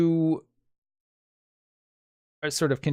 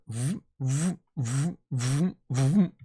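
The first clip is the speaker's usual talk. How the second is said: repeated rising mouth zooms; soft, swooping vocal noises; blaster sound effect; 4 dB quieter, slower than usual. Acted. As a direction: imitate whooshing fire in the distance. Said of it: "then you can hear".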